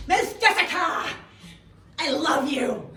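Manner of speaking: put-on high, loud child's declaration; little boy's voice. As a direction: gush like an eight-year-old. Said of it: "he would run up to me and go".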